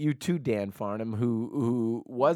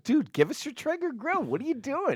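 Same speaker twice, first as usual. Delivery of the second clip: much higher, hammed-up plea for the grill; mock pleading; 5 dB louder than usual. Asking a friend for his Traeger, much higher mock plea. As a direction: squeak it out like begging a buddy. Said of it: "We're like".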